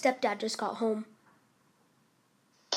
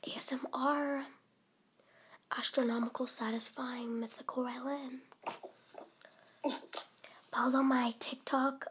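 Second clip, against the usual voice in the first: ASMR whisper; 7 dB quieter than usual. Real, not acted. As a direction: hush it like an ASMR intro. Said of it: "do A S M R".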